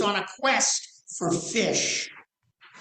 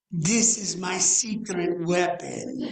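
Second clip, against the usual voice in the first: put-on slow, European accent, grandfather confiding; in a European accent, slow and knowing; slower than usual. Grandfather tells grandson in the garden, slow European accent. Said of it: "with a European accent".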